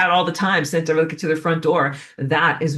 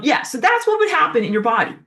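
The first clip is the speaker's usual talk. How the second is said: ordinary talk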